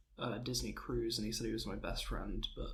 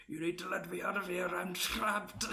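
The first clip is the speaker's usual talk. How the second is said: higher, mock-desperate Irish plea; imitated Irish man; 6 semitones higher than usual. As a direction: play a trapped Irishman calling from his coffin. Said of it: "an Irish guy".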